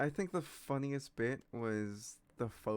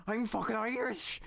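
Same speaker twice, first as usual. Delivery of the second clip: loud, high, slow thick Irish; Jacksepticeye; 11 semitones higher, 5 dB louder than usual. Retold or imitated. Player imitating a YouTuber, exaggerated Irish accent. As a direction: shout it in a hammed-up Irish brogue. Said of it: "Jacksepticeye is just like".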